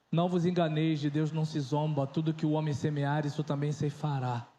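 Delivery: low, solemn pulpit voice, scripture quoted with weight; solemn sermon quote; preaching scripture. Acted. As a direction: deliver it like a pastor from the pulpit.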